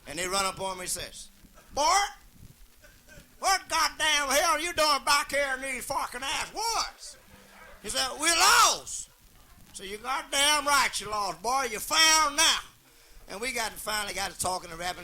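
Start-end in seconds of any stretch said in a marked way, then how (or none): 1.7-7.2 hillbilly shout. Acted. Hillbilly shouting at lost man. 8.2-9.1 mock lost cry. Lost man answering hillbilly. 9.7-12.6 hillbilly holler. Acted. Hillbilly replies to lost man.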